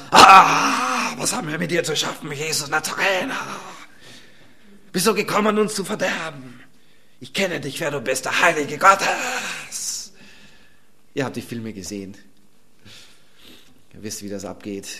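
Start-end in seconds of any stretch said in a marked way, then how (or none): 0.1-10.1 strained demon voice with a cry. Acted. Preacher voicing the demon to congregation, crying out. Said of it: "Und er schrie mit lauter Stimme".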